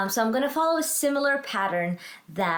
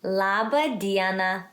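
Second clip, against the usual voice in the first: slow, drawn-out Laba diena for learners; language-teacher model; 2 semitones lower, slower than usual. Shown as a demonstration. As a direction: model it slowly for repetition. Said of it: "You say it".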